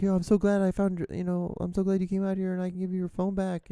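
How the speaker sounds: high, earnest imitation of a teen; teenage kid. Teenage kid returning a lost phone, high imitated voice. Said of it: "He was a k-, teenage kid".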